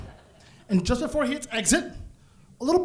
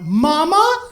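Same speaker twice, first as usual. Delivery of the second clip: louder, higher startled Mama; startled call; 8 semitones higher, 6 dB louder than usual. Retold or imitated. High son calling his mother, startled and louder. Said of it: "I stood up and went".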